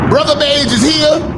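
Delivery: shouted high and loud like an announcer; hype shout. Acted. Host hyping himself up to his viewers, loud shout. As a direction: belt out your own entrance.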